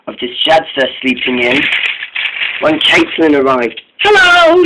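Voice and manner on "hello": bright high hello, much higher and louder; cheerful greeting shot up high. A narrator's reading. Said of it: "she said cheerfully".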